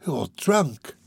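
lightly voiced contempt; scornful. A narrator's reading. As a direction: dismiss her with a touch of scorn.

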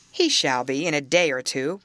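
plain reading voice

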